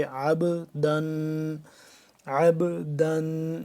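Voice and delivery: very slow عب دن with the ن of دن held in the nose; nasal ghunna. Shown as a demonstration. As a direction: stretch دن and hum it through the nose. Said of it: "ناک میں لے جائیں گے". (دن کی آواز کو ناک میں لے جا کر آہستہ)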